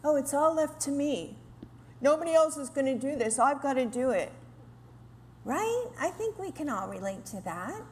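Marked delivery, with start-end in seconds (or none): 0.0-4.3 fast, lightly voiced martyr complaint; self-pitying martyr. Women feeling sorry for themselves, a lightly mocking martyr whine. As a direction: mildly ham up the put-upon sigh. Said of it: "behave like we're martyrs".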